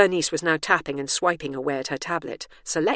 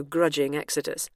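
plain reading voice

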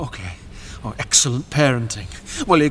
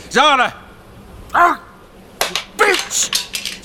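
shouting; 13 semitones higher, much slower than usual. Acted. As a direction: yell her name after her. Shouting after daughter.

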